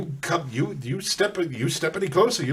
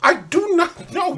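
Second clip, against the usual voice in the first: terrified cry; 5 semitones higher, 6 dB louder than usual. Acted. Refusing corruption in terror.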